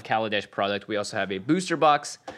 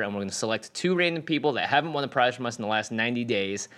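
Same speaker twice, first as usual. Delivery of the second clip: usual speaking voice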